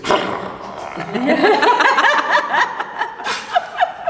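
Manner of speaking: a burst of high squealing laughter; big high laugh. Real, not acted. Mother laughing at her own fridge instinct, high squeal.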